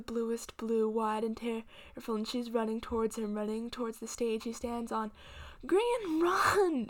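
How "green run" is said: high, loud screaming command; screamed. Acted. Grandmother screaming at Green to run. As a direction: scream his name and the order. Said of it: "she screams".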